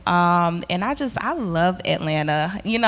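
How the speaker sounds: usual speaking voice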